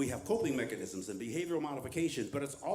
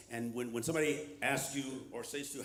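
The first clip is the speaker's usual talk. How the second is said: normal talking voice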